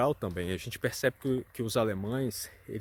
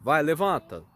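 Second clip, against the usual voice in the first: lightly imitated brisk parent, louder; mock parental order, pushed louder; pais mandando levantar; 2 semitones higher, 6 dB louder than usual. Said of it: "os pais têm uma coisa meio que". (pai mandando, imitado de leve)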